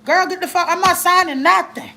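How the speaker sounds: high-pitched, indignant mock refusal; retold telling-off. Fed-up parent telling off the caseworker, mock-angry and high. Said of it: "this lady read her".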